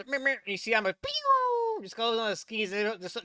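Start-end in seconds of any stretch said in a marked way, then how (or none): 0.0-0.4 higher-pitched cartoon meep meep; Roadrunner beeps. Reactor mimicking the fleeing skier, high cartoon beeping. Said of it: "all I hear is". 0.9-1.8 very high, loud, drawn-out "pew"; cartoon rocket whoosh falling away; imitated zoom. Reactor showing Imo shooting off on skis, cartoon zoom.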